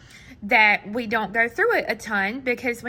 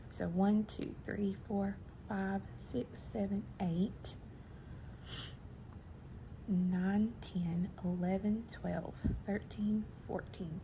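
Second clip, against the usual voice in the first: very soft count, dropping into whispers; counting under breath; 2 semitones lower, 13 dB quieter than usual. Real, not acted. Crafter counting beads to herself, near-whispered. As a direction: barely voice the numbers, just keeping track.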